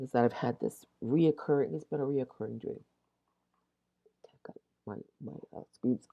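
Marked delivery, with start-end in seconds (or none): 4.3-5.6 hushed aside under the breath; whispering. Real, not acted. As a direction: drop to a quick whisper. Aside while screens go off.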